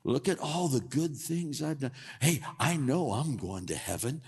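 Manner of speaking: strut through the words in pride; lightly voiced arrogant boast; prideful boast. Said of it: "he lifted himself up".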